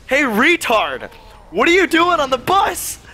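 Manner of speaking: high, drawn-out jokey call; mock shout across a bus; retold joking shout. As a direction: call it out teasingly, pitch rising sharply. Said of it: "I just shout it out".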